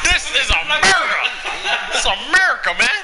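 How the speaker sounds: high, loud mock-patriotic shout; taunting shout. Gamer taunting a French opponent, loud high shout. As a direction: taunt them, loud and proud.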